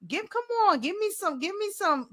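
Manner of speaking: high, coaxing mock plea; playful pleading. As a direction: wheedle the chatbot playfully. Pleading with chatbot for swag.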